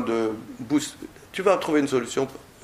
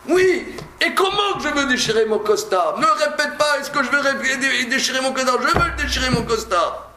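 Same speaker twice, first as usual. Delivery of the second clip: high, emphatic voice of a man bent on tearing his suit; insistent angry man; 10 semitones higher than usual. Pretend. Teacher voicing the angry man who insists on tearing. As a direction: insist hotly, as the man in the example.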